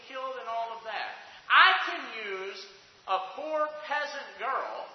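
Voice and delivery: sermon at its peak; full-force preaching. Acted. Preaching climax.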